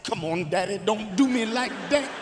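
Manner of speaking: mildly hammed-up pleading; mock breakdown. Son begging dad for his phone, mock breakdown. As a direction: imitate a child begging, half-tearful. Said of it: "He broke down".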